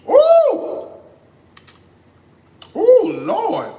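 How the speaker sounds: very high, loud ooh of delight; delighted squeal. Real, not acted. Cook tasting his corn gravy, high delighted squeal. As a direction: squeal at the first taste.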